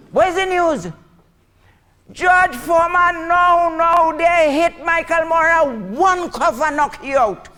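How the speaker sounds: high, loud shouted report; excited announcing. Acted. Tony shouting the fight result to Makume, excited. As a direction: proclaim it at the top of your voice.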